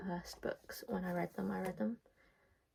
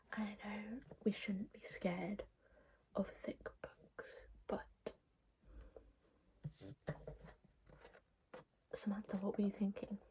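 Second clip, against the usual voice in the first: whispered, slow, playful reproach to the author; mock scolding whisper; 2 semitones higher, 8 dB quieter than usual. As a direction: hush it like a teasing complaint to Samantha.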